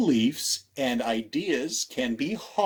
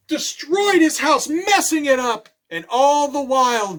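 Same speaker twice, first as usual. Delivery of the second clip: rising drama; 11 semitones higher, 8 dB louder than usual. A narrator's reading. Ransacking told with rising drama.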